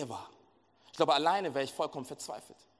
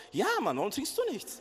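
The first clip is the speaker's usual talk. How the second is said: mock pushy; 10 semitones higher than usual. Brother-in-law presses him.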